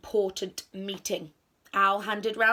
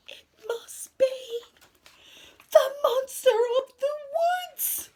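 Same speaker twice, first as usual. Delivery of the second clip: very high, slow wail, growing louder; horrified dread; 6 dB louder, slower than usual. A narrator's reading. Friends conclude the monster is outside, horrified wail. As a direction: build from hushed fear to a wail. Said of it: "they all whispered".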